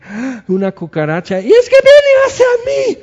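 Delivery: rising to a piercing loud shriek; hammed-up terrified scream; mock scream of panic. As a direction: squeal as if the bug charges you. Person panicking at cockroach.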